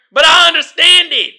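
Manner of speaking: loud, high-pitched protest; emphatic loud insistence; exasperated. Real, not acted. Exasperated about her speech.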